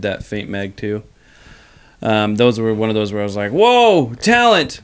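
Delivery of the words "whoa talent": amazed whoa. Retold or imitated. Excited reaction quoted.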